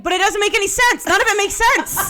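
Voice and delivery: high and loud, laughing at her own outrage; exasperated protest. Real, not acted. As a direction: argue it through half-laughs. Arguing the Bible makes no sense.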